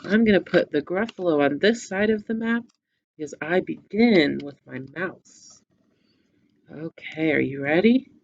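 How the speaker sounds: ordinary talk, nothing marked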